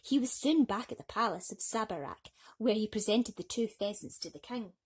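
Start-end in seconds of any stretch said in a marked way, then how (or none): none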